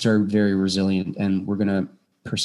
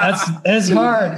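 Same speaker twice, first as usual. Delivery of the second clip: laughing; 9 semitones higher, 3 dB louder, much slower than usual. Real, not acted. Laughing while agreeing.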